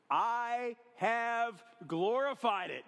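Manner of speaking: slow, booming voice of God from above; voice from heaven. Acted. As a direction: boom it out slowly like heaven speaking. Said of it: "get the sound effects going".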